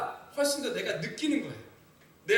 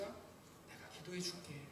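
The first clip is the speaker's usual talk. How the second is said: sincere promise; 4 semitones lower, 20 dB quieter, slower than usual. Retold or imitated.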